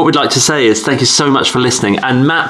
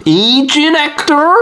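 high, slow, strained reversed voice; backwards speech; 14 semitones higher, slower than usual. Shown as a demonstration. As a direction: garble it like tape played backwards. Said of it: "I'll try it again".

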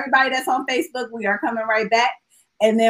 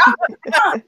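laughing; 11 semitones higher than usual. Retold or imitated. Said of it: "I'm like".

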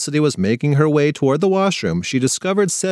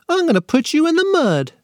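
put-on much higher, childish little-boy voice; toddler threat voice. A narrator's reading. Little brother threatening his sister in a high toddler voice. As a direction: pipe it up like a tiny tot.

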